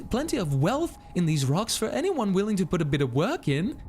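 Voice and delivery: high, hammed-up British accent; British character voice. Miner character calling to the player, high British accent. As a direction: voice the cave dweller in a lilting high British accent. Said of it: "I've just made a British voice for all of the characters".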